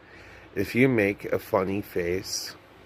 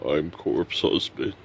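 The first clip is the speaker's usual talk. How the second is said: deep dark streamer voice; 4 semitones lower than usual. Pretend. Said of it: "I'm doing a [name] impression".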